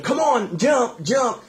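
put-on high, sing-song calling to a child; coaxing father. Father in the water urging his child to jump, high coaxing call.

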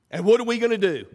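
normal talking voice

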